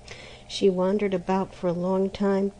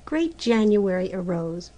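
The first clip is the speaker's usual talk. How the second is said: ordinary delivery, nothing marked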